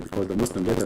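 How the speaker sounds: usual speaking voice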